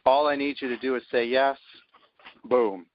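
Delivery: ordinary talk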